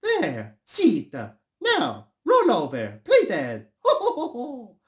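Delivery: high, loud, excited cartoon voice; high-pitched Mario impression. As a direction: call out like commanding a pet, ending in ho-ho laughs.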